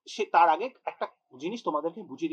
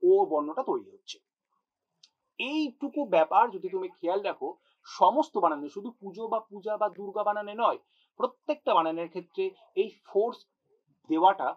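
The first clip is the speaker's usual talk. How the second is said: usual speaking voice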